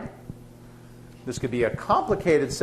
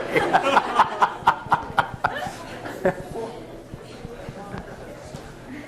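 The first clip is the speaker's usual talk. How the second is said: long airless laugh at himself; breathy laugh; 9 semitones higher than usual. Real, not acted. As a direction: laugh it off, silently shaking. Laughs after flopped joke.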